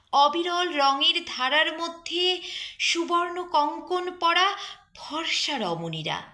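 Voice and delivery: high, declaiming poetry reading with long pauses; poem recitation. Acted. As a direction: recite it like a stage poem, full of longing. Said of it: "একটা প্রিয় কবিতা আমি আবৃত্তি করব". (আবেগভরা কবিতা আবৃত্তি)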